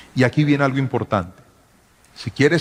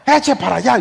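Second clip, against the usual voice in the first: high, loud mock scolding girlfriend; imitating girlfriend; 10 semitones higher, 3 dB louder than usual. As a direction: shoo him off in her high voice. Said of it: "se transformaba". (imitando a la novia, aguda y regañona)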